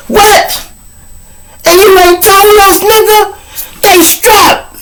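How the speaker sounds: high, alarmed shout; alarmed outburst. Retold or imitated. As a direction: gang leader shouting in shocked alarm. Gang leader alarmed at news.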